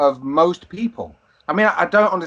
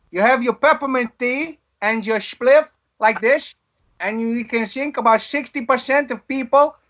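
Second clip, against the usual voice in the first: higher-pitched comic Dutch accent, s turned to sh; 5 semitones higher than usual. Shown as a demonstration. Friend mocks Dutch stoners in a coffee shop, Dutch accent. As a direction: ham up a slushy Dutch accent. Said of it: "That's the accent".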